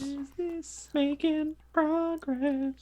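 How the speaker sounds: high sing-song. Pretend. Playful drawn-out exclamation.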